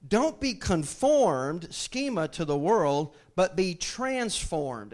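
slow, weighty reading of the verse; deliberate preacher reading; slow scripture reading. Acted. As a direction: lean on each word of the verse.

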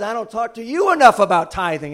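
booming imitation of the pastor's complaint; mock shout. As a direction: shout it like Jim in full voice. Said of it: "Jim says".

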